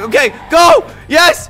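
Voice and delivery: high, loud burst; urgent triumphant shout. Real, not acted. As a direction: shout it on, then cheer. Urging car on, it works.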